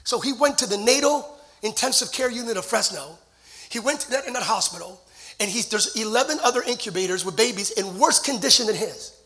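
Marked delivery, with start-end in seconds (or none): none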